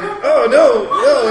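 high, loud no no through laughter; giggling mock alarm; laughing protest. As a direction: fend him off while cracking up. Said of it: "And I'm like".

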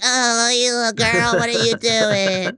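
very high, slow sing-song coo; baby talk to dog. Retold or imitated. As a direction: gush over your dog in drawn-out baby talk. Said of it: "I totally do that queer voice".

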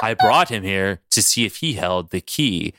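low, composed villain; Mister Sinister. Acted. Sinister answers Logan's outburst, low and composed. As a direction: explain it deep and unruffled. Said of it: "Sinister's like".

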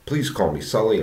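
usual speaking voice

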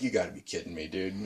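low, very soft aside; quietly incredulous; stunned disbelief. Retold or imitated. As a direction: imitate a rattled friend under the breath. Terrified friend's stunned reaction, retold.

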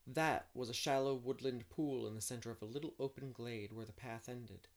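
measured audiobook storytelling; story narration. A narrator's reading. Narrator setting the scene of a spring picnic day, storytelling. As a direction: read the scene aloud, shaping each phrase.

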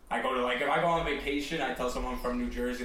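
ordinary talk

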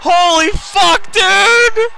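excited shout. Real, not acted. Cheering the arrival.

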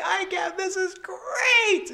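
high, loud cry stretched out slowly; imitated hysterical elation. Imitating mother laughing through labour, delirious high shout. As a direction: wail it in mock delirious joy. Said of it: "It was crazy laughing".

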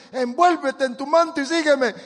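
high, loud angelic order; loud command. Retold or imitated. Angel ordering Pedro to dress, loud high command. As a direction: ring it out like a summons. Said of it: "le dijo el ángel".

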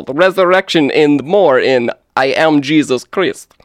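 hammed-up bad Russian accent. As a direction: read it in a terrible Russian accent. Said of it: "I'm gonna do my best to do a terrible Russian accent".

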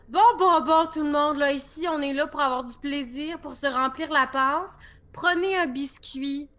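loud, cheerful call to the crowd; jolly peacemaker offering cookies; cheery hostess. Acted. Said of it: "en faisant".

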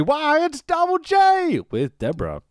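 high, slow, drawn-out ring intro; mock announcer. Podcaster hyping Jeff Jarrett's entrance, slow high announcer call.

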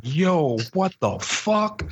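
high, drawn-out incredulous reaction; retold disbelief. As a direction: stretch it out in mock bewilderment at no extra volume. Retelling shocked reaction to bad acting.

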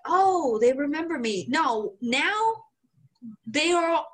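ordinary talk, nothing marked

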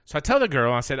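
ordinary talk, nothing marked

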